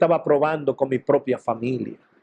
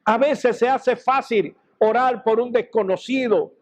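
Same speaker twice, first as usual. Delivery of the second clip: preaching; 6 semitones higher than usual. Real, not acted. Preacher builds to exhortation.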